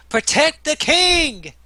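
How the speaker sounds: high, loud, drawn-out battle cry; Chim yelling out. Acted. Player's character rallying guards to the king, full yell. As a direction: yell it out long, as a rallying call. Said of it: "Chim's gonna yell this out".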